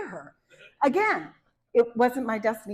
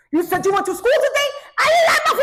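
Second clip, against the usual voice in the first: higher and louder, laughing mom yell; 13 semitones higher, 10 dB louder than usual. Retold or imitated. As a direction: blow up at your kid, loud and high. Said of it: "sorry, I don't mean to yell".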